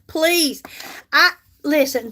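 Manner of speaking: ordinary talk, nothing marked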